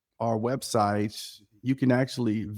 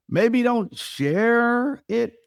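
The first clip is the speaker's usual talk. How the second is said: maybe don't share it, dicho lento y más agudo; slow emphatic; 10 semitones higher, 3 dB louder, much slower than usual. Acted. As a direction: stretch out "maybe don't share it" slowly and high. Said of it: "I'm just spitballing here".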